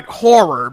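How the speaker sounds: "horror" with both syllables, hor-ror; enunciated correction. Shown as a demonstration. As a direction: give "horror" its full "-ror" ending. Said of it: "enunciate the last part of the word".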